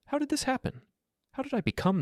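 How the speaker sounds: high, strained voice close to breaking; despairing wife. Acted. Wife asking her husband how this happened, despairing. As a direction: let her voice crack in despair.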